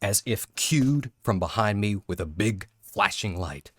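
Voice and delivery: ordinary talk, nothing marked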